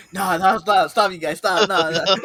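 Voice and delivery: loud, high, flustered refusals; mock a shy guy begging friends to stop; imitated bashful protest.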